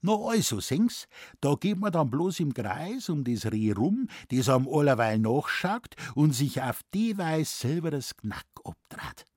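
high-pitched Bavarian forester, triumphantly explaining; Moosbichler. Acted. Forester explains the deer trick to gullible scholar, matter-of-fact Bavarian. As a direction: spin the tall tale in dialect.

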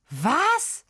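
high, rising "what" of disbelief; perplexed outcry. A narrator's reading. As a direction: blurt it out, completely taken aback. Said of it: "war völlig perplex". (völlig perplex ausgerufen)

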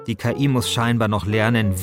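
ordinary delivery

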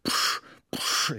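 short voiceless puffs of effort; breathless pedalling puffs; panting breaths. Acted. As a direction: puff hard like a straining cyclist. Cyclist panting uphill.

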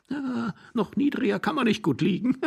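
high sneering joke; mocking laugh. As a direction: laugh at him. (höhnisch lachend)

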